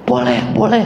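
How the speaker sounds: high, touched acceptance; moved voice. Retold or imitated. Woman accepts the pastor's prayer, moved and high. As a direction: accept the prayer, voice caught with emotion. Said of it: "Suaranya udah, apa, udah terharu".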